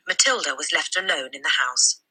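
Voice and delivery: ordinary delivery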